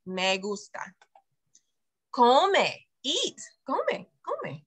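ordinary delivery

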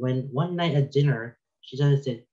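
usual speaking voice

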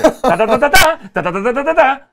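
exaggerated Puerto Rican lilt on nonsense syllables; sing-song accent. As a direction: rattle out "ta" syllables in a mock cantadito tune. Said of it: "ustedes hablan como cantadito".

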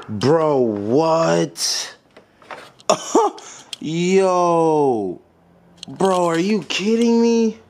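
incredulous, pitched high, half laughing; can't believe what just happened; stunned outrage breaking into a laugh; disbelief. Real, not acted. Bulb falls into taillight.

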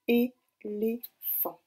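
"Éléphant" très lent, é... lé... phant; séparer nettement les trois syllabes de "Éléphant"; découpé en syllabes. Shown as a demonstration. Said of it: "Donc on écoute bien". («Éléphant» dit très lentement, syllabe par syllabe)

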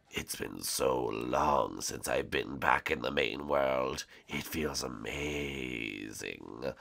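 low, slow villain voice; dark lord. Acted. Villain returns to main world.

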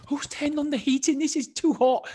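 high indignant complaint; hammed-up outrage at the heat; complaining about the sudden heat; mock complaint.

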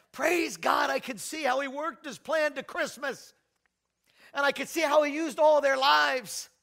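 high, loud preaching climax; preaching at full force. Real, not acted. Preacher proclaims God's plan to the congregation, ringing preaching. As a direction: lift the congregation with a ringing cry.

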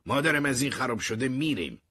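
high, loud call across the street; shouting. Acted. Dave shouting to Terry from a stolen jeep, loud and high. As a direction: yell it out from the jeep. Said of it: "دیو داد زد".

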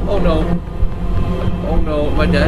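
loud, high cry of dread; panicked. Real, not acted. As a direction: call out in alarm at losing. Panicking as game threat hits.

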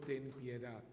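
ordinary talk, nothing marked

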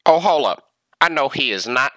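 ordinary talk

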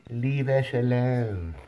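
slow, put-on grumpy puppy voice. Puppy voicing owner's intrusion, slow grumpy put-on voice. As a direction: voice the puppy telling you to go away.

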